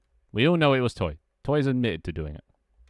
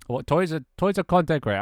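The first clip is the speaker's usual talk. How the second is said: higher, louder surprised outburst; startled disbelief, pitched up; 7 semitones higher, 4 dB louder than usual. Real, not acted. Streamer startled that Toy is a content creator, higher and louder.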